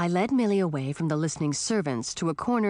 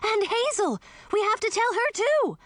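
alarmed. A narrator's reading. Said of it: "Millie gasped".

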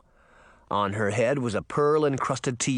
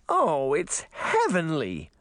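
slow, higher, mouth-full delight; 4 semitones higher, slower than usual. A narrator's reading. Princess tasting cake, slow words with mouth full. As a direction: savor it slowly through a mouthful. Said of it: "said with her mouth full".

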